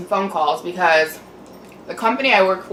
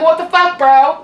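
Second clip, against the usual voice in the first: raised, louder exasperated yell; higher, louder disbelieving snap at the man; angry outburst; 7 semitones higher, 5 dB louder than usual. Retold or imitated. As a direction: blurt it out, fed up and furious. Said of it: "Now I'm yelling at you guys".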